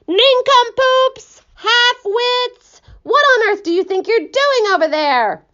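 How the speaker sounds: very high, slow yelled insults; yelling. Acted. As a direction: taunt them from afar. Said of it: "yelling at the cloud men as loud as he could".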